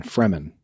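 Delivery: "Fremen" as FREM-en, low and falling; correct pronunciation of Fremen. Shown as a demonstration. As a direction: finish the correction with "Fremen", r before short e. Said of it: "you like to mispronounce things".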